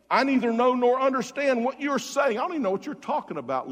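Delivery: loud, high-pitched protest; indignant denial. Retold or imitated. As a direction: deny it hotly, rushing the second sentence. Acting out Peter's indignant denial.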